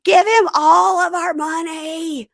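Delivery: high, loud, drawn-out wail; stretched, overwrought high cry; mock desperate plea. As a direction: implore Daniel in hammed-up panic. Voices Lisa pleading with Daniel.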